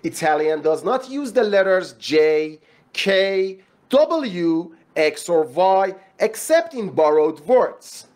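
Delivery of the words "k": ordinary talk, nothing marked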